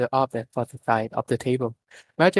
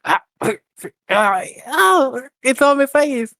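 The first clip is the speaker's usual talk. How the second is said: high, loud, smushed yelps; squashed cry; 12 semitones higher, 8 dB louder, slower than usual. A narrator's reading. Minako crying out while smushed in Camilla's hug, higher and louder. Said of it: "Minako cried as she got smushed".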